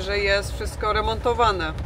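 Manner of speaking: normal talking voice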